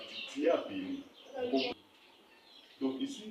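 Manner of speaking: ordinary delivery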